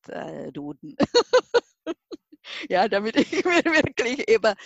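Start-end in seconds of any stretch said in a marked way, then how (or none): 1.0-1.6 sudden high bright laugh; burst of laughter. Real, not acted. Guest laughing at her own Duden habit, a loud high laugh. 2.7-4.5 laughing. Real, not acted. Laughing while explaining.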